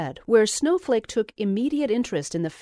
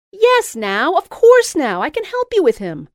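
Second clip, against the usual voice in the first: high, loud insistence; eager insisting; 9 semitones higher, 9 dB louder than usual. A narrator's reading. Louise insisting to Jessica, high and loud. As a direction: brush aside the doubt.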